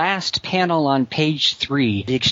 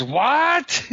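much higher, squeaky mock "What?"; Manga Khan's baffled what; 13 semitones higher than usual. Retelling a comic gag, villain's squeaky mock "What?". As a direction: squeal it like a dim villain caught off guard. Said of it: "he says".

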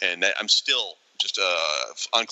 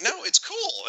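high, airy, clueless reassurance; brushing it off brightly without a clue; mock breezy; slower than usual. Retelling the photo mix-up, [name] voices himself breezy and high. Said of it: "I'm like".

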